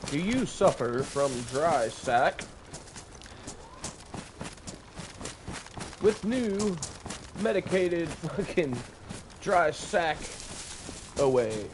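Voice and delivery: slow, overdone TV ad pitch; mock commercial. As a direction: sell it like a cheesy infomercial. Mock TV ad for dry sack.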